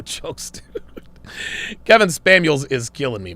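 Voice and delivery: ordinary talk, nothing marked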